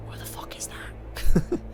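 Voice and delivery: breathy whisper aside, slow. Real, not acted. Player breaks character to whisper a joke. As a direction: hush it out of character, slowly. Aside during solemn reading.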